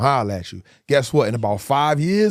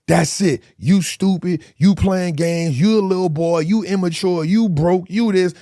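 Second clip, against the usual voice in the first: louder, higher mocking jabs; higher, louder scornful list of insults; 6 semitones higher, 4 dB louder, slower than usual. Mocking insults people throw.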